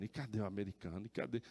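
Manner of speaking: soft, low, quick asking under the voice; bewildered searching. Retold or imitated. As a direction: ask yourself, hushed and at a loss. Looks around for missing companion. (perplexo, em voz baixa)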